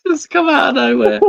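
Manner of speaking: high laughing voice. Real, not acted. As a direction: squeal it out mid-laugh. Laughing at interruption.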